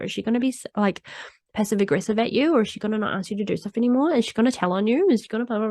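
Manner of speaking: rapid-fire worried questions tumbling out; anxious rush. Retold or imitated. Her inner critic spirals about an upset colleague, fast and anxious. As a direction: race through the worries without a breath.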